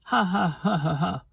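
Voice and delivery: high mocking bleat of laughter. A narrator's reading. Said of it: "bleated a big lamb".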